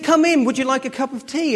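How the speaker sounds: sing-song Mister Happy welcome; cheery welcome. Acted. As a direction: greet him like a beaming neighbour. Friendly neighbour welcoming dad.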